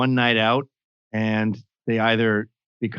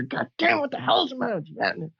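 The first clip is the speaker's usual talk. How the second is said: mock yell, pitched much higher; retold angry father; 12 semitones higher, 4 dB quieter than usual. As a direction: ham up the furious dad. Father yelling at crying kid.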